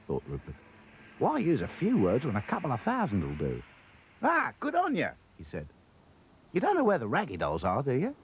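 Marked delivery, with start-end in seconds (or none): 1.2-8.1 raised, lively Aussie twang; a chirpy kangaroo chatting; Australian kangaroo. Acted. Kangaroo Rupert's voice.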